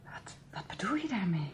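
stunned, breathless whisper; shocked whisper. Acted. Told her car was sabotaged, she whispers in shock. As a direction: barely voice the question in disbelief.

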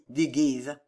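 ordinary delivery

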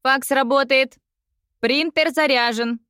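high, creaky robot servant; metallic robot voice. Acted. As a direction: scrape it out like a metal machine. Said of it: "проскрипел Имамура металлическим голосом".

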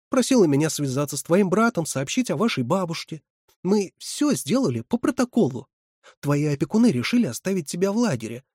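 high, emphatic reprimand; indignant lecturing. A narrator's reading. Резина tells Исаев all was done properly, indignant.

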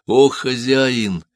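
usual speaking voice

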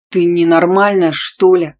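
loud outburst at normal pitch; loud, indignant man's outburst; angry roar. A narrator's reading. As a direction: roar it at them, outraged. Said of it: "рявкнул он".

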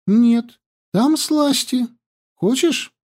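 put-on high, lively young girl; girl's voice. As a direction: chatter high and breathless.

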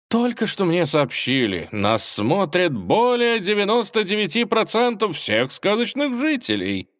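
stage host. Acted. Host boasting ratings.

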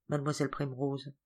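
plain reading voice

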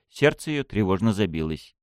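usual speaking voice

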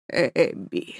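father gasping last orders to daughter; dying whisper. Acted. (тихо, шёпотом, умирая)

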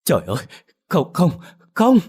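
stammered no's rising high and loud; a panicked, breaking cry of no; stammering in terror. A narrator's reading. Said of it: "miệng lắp bắp". (lắp bắp hoảng sợ, cao và to dần)

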